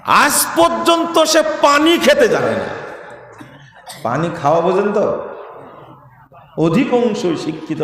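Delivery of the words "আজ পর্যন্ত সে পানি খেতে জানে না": high, slow scornful jab; mocking outrage. Real, not acted. (উঁচু গলায় ধীর বিদ্রূপ)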